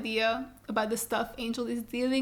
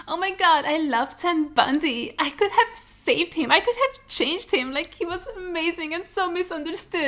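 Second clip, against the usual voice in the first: gushing fangirl; 6 semitones higher than usual. Pretend. Vlogger mocks true crime fangirls, high and swooning. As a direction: gush like a starry-eyed true crime fan. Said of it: "who are like".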